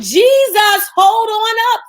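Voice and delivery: high mock shout after a passerby; hammed-up Bartimaeus yelling for Jesus; calling out to Jesus. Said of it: "blind Bartimaeus was like".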